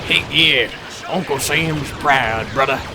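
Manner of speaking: slow, hammed-up Southern American drawl; worst Southern American accent. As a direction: do a terrible Southern US accent, drawn out. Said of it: "That's, like, the worst".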